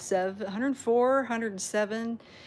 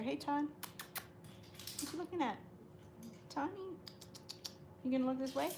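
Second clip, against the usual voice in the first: soft pet talk; 6 semitones higher, 12 dB quieter, faster than usual. Real, not acted. Owner softly coaxing Tawny to look at the camera, high soft baby talk. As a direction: gently coax the cat to look over.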